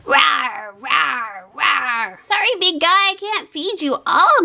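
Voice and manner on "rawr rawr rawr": huge fiery dragon roar. Pretend. Kid feeding big toy animal.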